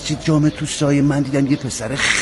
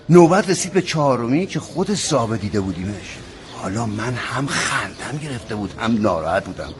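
ordinary talk

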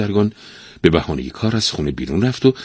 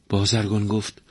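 ordinary delivery, nothing marked

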